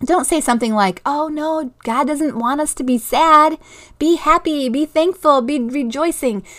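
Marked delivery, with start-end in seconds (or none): 1.0-6.4 higher-pitched mock cheery scolding; chirpy admonishing. Parent brushing off a child's sadness, mock cheery admonishing. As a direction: imitate an over-positive parent correcting sadness. Voices what not to say.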